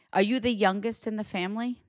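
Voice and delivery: ordinary delivery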